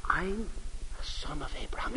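breathy unvoiced whisper. Whispering his secret to the wife in a restaurant, whispered. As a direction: reveal it in a hushed secret whisper. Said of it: "we're now all talking in whispers".

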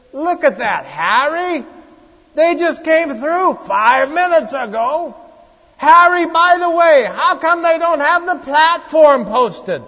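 loud nasal New Yorker shouting at Harry; New York accent. Retold or imitated. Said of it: "My favorite lady said".

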